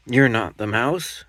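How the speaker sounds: high, loud surprised little monster; a cub questioning the snake; Gruffalo's child. Acted.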